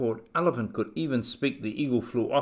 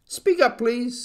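high, raised request; calling to be heard; 12 semitones higher, 5 dB louder than usual. A narrator's reading. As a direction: call up high to a faint voice. Horton calls to a faint voice.

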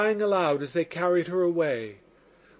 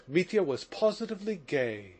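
plain reading voice

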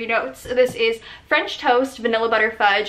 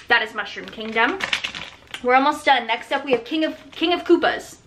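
ordinary talk

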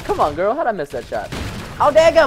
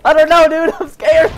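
higher, louder half-laughing panic; laughing and scared; 7 semitones higher, 5 dB louder than usual. Real, not acted. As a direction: shout it out, giddy and scared. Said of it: "I'm laughing and scared".

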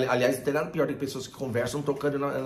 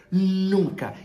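"nunca" higher and much louder; stress on NUNCA; 6 semitones higher, 7 dB louder than usual. Shown as a demonstration. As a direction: punch "nunca" hard. Emphatic rule.

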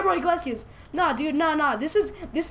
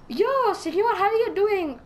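higher-pitched hollered hello; playful mock greeting; 7 semitones higher, 4 dB louder, slower than usual. Player hailing a man in the game, playful mock greeting. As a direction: call out in a playful mock-friendly way.

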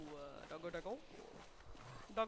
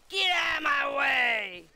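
shout; 4 semitones higher, 10 dB louder, slower than usual. Real, not acted. Charging through, boar hunt.

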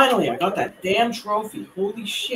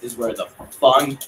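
ordinary delivery

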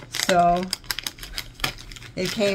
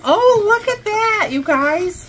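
excited; 13 semitones higher than usual. Real, not acted. Socks with faces.

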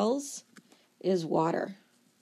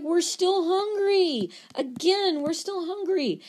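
hungry cells; 9 semitones higher than usual. Acted. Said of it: "And they're like".

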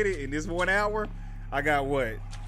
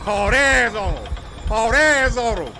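loud, drawn-out holler; excited shout; 7 semitones higher, 9 dB louder, slower than usual. Real, not acted. Player hollers in the middle of a chase, shouting. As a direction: holler it high and long.

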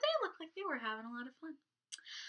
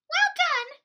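much higher, louder bird praise; tall bird voice; 10 dB louder than usual. Acted. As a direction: squeak it out high. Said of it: "said the very tall bird".

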